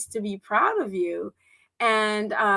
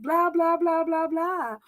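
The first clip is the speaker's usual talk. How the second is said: mock chatter; 5 semitones higher, slower than usual. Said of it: "I was just like".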